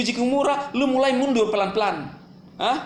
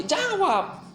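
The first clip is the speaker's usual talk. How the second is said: demanding answer; 8 semitones higher than usual. Retold or imitated. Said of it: "Saya tanya".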